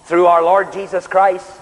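ordinary delivery

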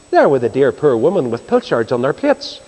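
usual speaking voice

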